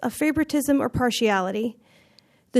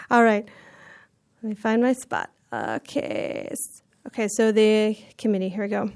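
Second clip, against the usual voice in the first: ordinary delivery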